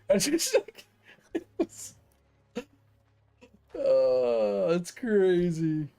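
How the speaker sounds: high, breaking into real laughter; laughing disbelief. Real, not acted. Laughing at the story.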